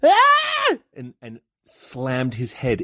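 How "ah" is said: put-on piercing high baby scream; baby shriek. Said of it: "the baby went".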